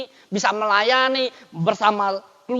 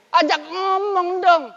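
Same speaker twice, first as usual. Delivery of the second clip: drawn-out urging; 8 semitones higher, 4 dB louder, slower than usual. Real, not acted. Pastor urging the congregation.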